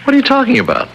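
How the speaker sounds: baffled, as if the claim makes no sense; incredulous. Acted. Husband caught off guard by talk of his footprints, incredulous. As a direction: pitch jumps high in disbelief.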